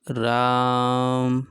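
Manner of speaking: राम with its vowel stretched very long; prolonged Ram. Shown as a demonstration. Teacher showing a threefold-long vowel, very drawn out. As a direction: pull the आ of राम out like a call. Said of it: "जैसे राम बोलते हैं ना".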